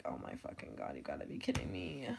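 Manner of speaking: breathy hushed disbelief under the breath; exasperated whisper. Retold or imitated. To herself over spilled wax, a hushed exasperated whisper. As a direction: whisper it like you can't believe the mess.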